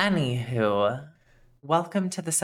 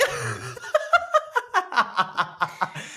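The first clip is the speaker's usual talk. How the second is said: laughing; 11 semitones higher than usual. Real, not acted. Laughs after confessing sloth voice.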